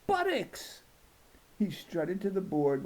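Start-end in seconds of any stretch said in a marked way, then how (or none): 0.1-0.8 high proud shout of the word; proud yell to the whole class. Acted. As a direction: blurt it out like a prize. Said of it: "he yelled".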